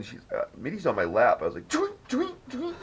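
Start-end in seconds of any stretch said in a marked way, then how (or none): 1.7-2.7 high chirped tweets; bird chirp imitation. Mock bird call joke.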